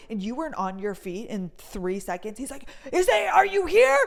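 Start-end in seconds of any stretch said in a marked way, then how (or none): 2.9-4.0 high, loud demanding call; impatient shout. Retold or imitated. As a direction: shout for someone who isn't standing yet. Guard shouting for missing person.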